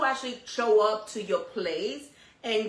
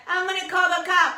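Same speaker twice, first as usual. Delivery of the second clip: high, louder mock child threat; threatening kid; 9 semitones higher, 5 dB louder than usual. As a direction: imitate a defiant kid threatening a parent. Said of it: "And then some kids, they be like".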